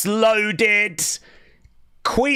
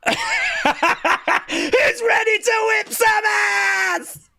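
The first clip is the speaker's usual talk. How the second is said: stretched, shouted hype call; 9 semitones higher, much slower than usual. Retold or imitated. Fan echoing [name] to the crowd, stretched shouted hype call.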